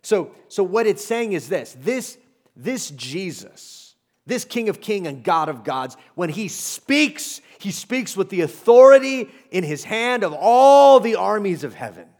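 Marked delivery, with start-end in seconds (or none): none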